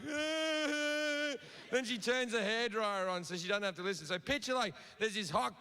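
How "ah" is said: high mock wail of a crying girl; hammed-up high-pitched whimper. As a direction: squeal a fake sob, way up high. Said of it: "she's crying like".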